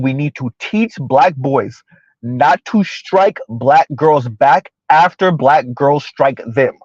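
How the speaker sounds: slow, measured, word by word; slow and deliberate. Real, not acted. Host repeating what the caller said, slow and deliberate. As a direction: lay it out slowly and clearly. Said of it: "gonna talk slow so I can be very deliberate".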